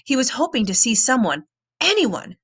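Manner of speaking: ordinary delivery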